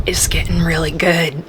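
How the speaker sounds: breathless excited whisper. Real, not acted. Reader whispering to viewers about the plot. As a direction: hush it like a secret thrill.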